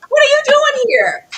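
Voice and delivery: squealing high and loud, laughing through it; high excited mock recognition. Mock shriek at spotting the actor from She Devil. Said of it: "I was like".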